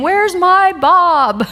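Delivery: loud, high, slow whine; imagined disappointed audience. Retold or imitated. Audience imagining their absent star speaker, whining loud and high. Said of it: "I could hear people in my imagination saying".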